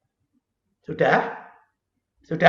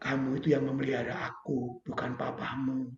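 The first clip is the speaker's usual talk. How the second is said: God speaking calmly; 6 semitones lower, 11 dB quieter than usual. Retold or imitated. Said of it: "Tuhan ngomong".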